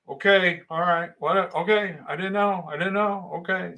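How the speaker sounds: flustered appeasing. Retold or imitated. Young new worker to scolding foreman, flustered and appeasing. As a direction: act out a newcomer backing down.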